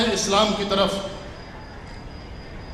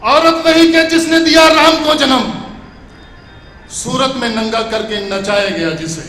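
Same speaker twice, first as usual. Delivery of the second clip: stately nazm recitation; 6 semitones higher, 12 dB louder than usual. Acted.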